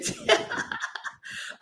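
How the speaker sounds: high-pitched breathy laugh. Real, not acted. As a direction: burst out laughing at the rival. Laughing at rival pundit.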